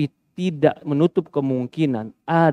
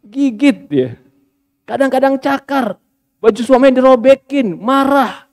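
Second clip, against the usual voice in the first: higher, rising indignation; high indignant list; heated listing; 7 semitones higher than usual. Real, not acted. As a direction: pile up the outrages. Listing wife's attacks, heated.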